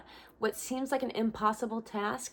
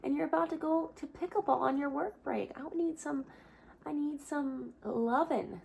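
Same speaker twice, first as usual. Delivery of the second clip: high, cutesy pet voice, much higher, slowing to a plea; Bella the dog; 8 semitones higher than usual. Pretend. Voicing her dog Bella, who wants cuddles before pickleball, in a high cutesy pleading voice. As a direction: speak for the pampered dog begging for attention. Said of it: "She's like".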